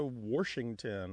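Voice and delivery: "Washington" said as "Worshington"; intrusive R. Shown as a demonstration. Host giving dialect examples, intrusive-R pronunciation. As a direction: put an R before the sh in "Washington". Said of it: "sometimes you'll hear they go to".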